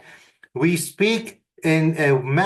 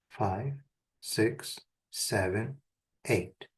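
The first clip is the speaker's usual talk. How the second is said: guided breathing count; 4 semitones lower, slower than usual. Shown as a demonstration. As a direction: coach the breath in and out, fading. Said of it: "Let's do it one more time".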